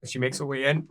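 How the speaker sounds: normal talking voice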